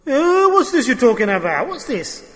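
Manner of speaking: hammed-up incredulous sneer at the teaching; scoffing skeptic. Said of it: "Now the person will speak".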